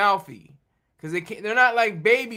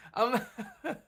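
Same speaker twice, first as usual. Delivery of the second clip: laughing; 4 semitones higher, 4 dB quieter than usual. Real, not acted. Host cracking up at story.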